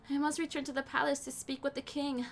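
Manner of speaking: usual speaking voice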